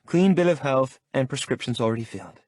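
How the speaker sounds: put-on impatient young woman; a patient arguing to go home; Lola's voice. A narrator's reading. Lola voiced in the story.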